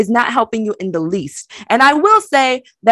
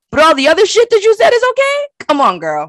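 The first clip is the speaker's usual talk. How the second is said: incredulous; 8 semitones higher than usual. Retold or imitated. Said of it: "one of your girlfriends in that group says".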